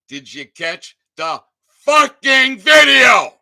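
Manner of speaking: furious, drawn-out shout. Real, not acted. Ranting vlogger shouting at police officers, furious. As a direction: yell it at the cops, loud and slow.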